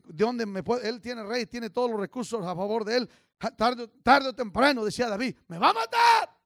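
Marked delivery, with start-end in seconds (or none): 5.5-6.4 high, loud acted shout of desperation; sudden desperate outburst, pitched high; desperate cry. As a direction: cry out as a hunted man would. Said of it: "era un hombre desesperado".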